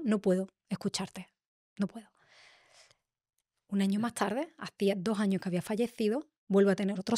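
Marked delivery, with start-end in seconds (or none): 0.0-2.1 más bajo, con un hilo de voz entre lágrimas; suplica llorosa. Retold or imitated. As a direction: ruega al abuelo casi sin voz, llorando. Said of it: "lloraba, lloraba". (súplica llorosa y muy baja)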